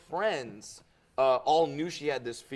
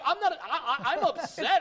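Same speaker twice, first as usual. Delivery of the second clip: squeaky, laughing protest; mock upset high voice; 13 semitones higher than usual. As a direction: play it hurt but laughing. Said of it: "I'm like".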